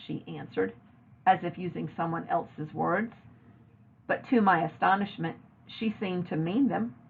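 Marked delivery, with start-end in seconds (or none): none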